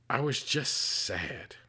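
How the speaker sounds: slow, heavy, sad repeat; real sadness. Real, not acted. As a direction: let the grief slow every word. Repeats the line, grieving uncle.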